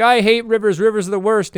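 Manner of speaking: fed up and throwing a little tantrum; mock frustrated outburst. Listener washed up on shore, whining about rivers, mock frustrated outburst.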